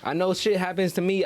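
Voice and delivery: ordinary delivery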